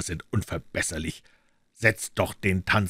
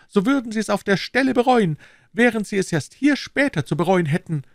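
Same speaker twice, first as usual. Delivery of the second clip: high, anxious servile voice; bow and scrape with every word; nervous, eager innkeeper; fawning innkeeper; 14 semitones higher, 4 dB louder than usual. A narrator's reading.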